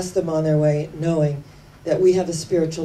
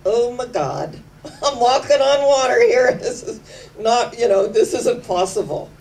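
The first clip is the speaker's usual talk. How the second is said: higher, laughing gasp of disbelief; startled disbelief; 5 semitones higher than usual. Retold or imitated. Peter realizes he's walking on water, laughing in shock. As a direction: sound as if you've just noticed you're on water. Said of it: "and realized".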